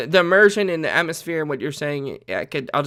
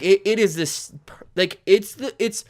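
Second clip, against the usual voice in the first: ordinary talk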